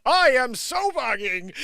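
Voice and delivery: loud, high, slow theatrical declaiming; booming stage recital of a silly line; mock Shakespearean. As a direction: read it out grandly like Shakespeare. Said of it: "I'm reading it like Shakespeare".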